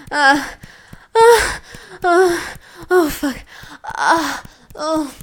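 loud, high, crying out; building climax. Acted.

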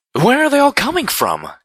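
high, loud panicked cry; alarmed cry. A narrator's reading. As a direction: shout it over gunfire. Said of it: "cried out Velvet Remedy".

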